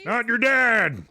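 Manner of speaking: high-pitched deadpan correction; character retort. Acted.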